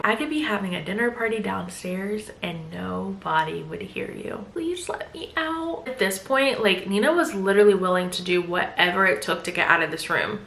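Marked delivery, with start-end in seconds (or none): 0.0-4.4 soft, fading to a whisper; menacing hush. Retold or imitated. Husband taunting wife that nobody hears, hushed. 4.5-5.8 much softer, slow, voice-lost plea; hoarse plea. Retold or imitated. Wife with screamed-out voice begging, soft and slow. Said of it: "So her voice is hoarse".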